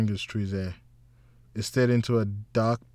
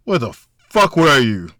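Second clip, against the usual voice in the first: loud, higher angry demand; 5 semitones higher, 11 dB louder than usual. A narrator's reading. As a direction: bark at him down the phone. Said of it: "Mike's angry voice".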